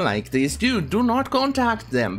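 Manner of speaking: usual speaking voice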